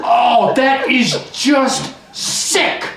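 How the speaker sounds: high, drawn-out cry of disgust; disgusted outburst. Acted. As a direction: erupt in slow, revolted outrage. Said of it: "what happened to the whole".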